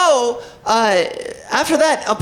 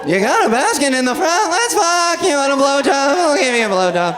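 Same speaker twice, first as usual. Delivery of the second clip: high, excited sitcom comedian voice; hammed-up comedian, stretching the words out; Seinfeld; 4 semitones higher than usual. Comic propositioning the catcaller, excited sitcom-star voice. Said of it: "I fucking sound like Seinfeld".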